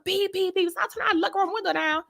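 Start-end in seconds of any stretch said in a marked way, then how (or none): 0.1-0.7 high-pitched spoken honks; insistent high beeping; car horn imitation. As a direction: mimic a horn that will not stop. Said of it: "They just keep going".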